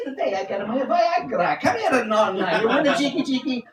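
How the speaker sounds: hammed-up randy Italian old man; Grandpapa Dolmio. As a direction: proposition grandma in a comic Italian voice. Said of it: "Grandpapa Del Mio".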